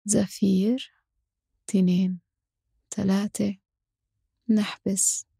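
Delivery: slow, calm breath counting; guided breathing count. Acted. Counting breath cycles slowly. (عدّ التنفس بهدوء وببطء)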